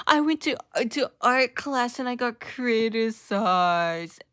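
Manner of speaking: high, slow, loud exaggerated whimpering; hammed-up whine; mocking whiny millennials. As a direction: parody them moaning about their problems. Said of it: "and just going".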